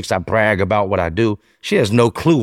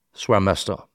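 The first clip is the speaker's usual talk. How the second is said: ordinary talk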